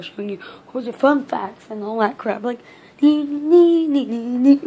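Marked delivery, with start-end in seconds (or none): 3.0-4.6 silly nee-nee noise. Pretend. Goofy noise, then apologizes.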